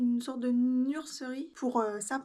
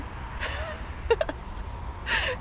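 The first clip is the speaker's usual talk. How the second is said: laugh. Real, not acted. Exhausted under heavy bag.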